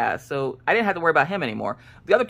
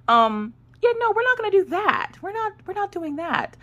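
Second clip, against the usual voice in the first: high sing-song no-way, much higher; mock refusal. To viewers about violence, a high mock refusal. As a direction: playfully shut it down.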